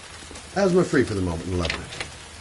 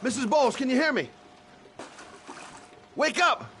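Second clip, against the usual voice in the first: urgent shouting. Acted. Trying to wake injured woman.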